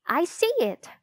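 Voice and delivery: high, eager exclamation; excited cry. A narrator's reading. Ruth Rose spotting the nest, excited cry. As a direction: blurt it out, thrilled to spot it. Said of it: "Ruth Rose cried".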